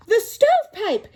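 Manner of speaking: high, loud startled cry; alarmed gasp. A narrator's reading. Third javelina spotting Coyote in the stovepipe, alarmed. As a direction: blurt it out in alarm. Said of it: "gasped the third little javelina".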